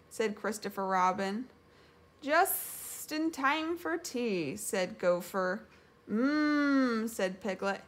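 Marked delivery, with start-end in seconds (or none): 2.2-4.5 high, slow, stretched S; whistling Gopher. A narrator's reading. Gopher pleased that cookies arrive, whistling. 6.1-7.1 long, higher-pitched yummy hum; savouring hum. A narrator's reading. Piglet tasting grandma's cookies, savouring hum.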